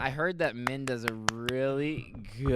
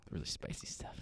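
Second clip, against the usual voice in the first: whispering; 11 dB quieter than usual. Pretend. Whispering a joke aside.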